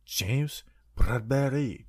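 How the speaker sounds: slow, whispery mock French accent. Host coming back from break with the player's name, mock French accent. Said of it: "I did a French accent".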